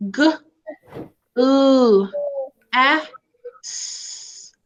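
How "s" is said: a long hissed "s", breathed with no voice; snake sound s. Shown as a demonstration. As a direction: hiss the "s" like a snake. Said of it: "Sammy Snake and Sally Snake say s".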